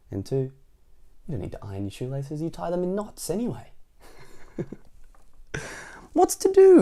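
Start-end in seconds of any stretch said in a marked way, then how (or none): 6.1-6.8 high, loud character exclamation; fussy self-talk. A narrator's reading. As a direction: exclaim to yourself, pitched well up. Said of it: "he murmured to himself".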